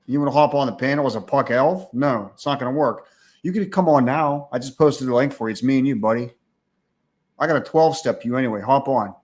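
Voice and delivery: usual speaking voice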